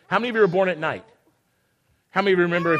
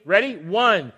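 ordinary delivery

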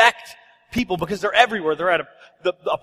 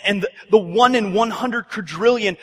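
normal talking voice

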